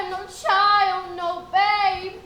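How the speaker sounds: projected stage line; declaiming. Shown as a demonstration. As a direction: play it to the balcony. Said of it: "That's it. Play to the balcony".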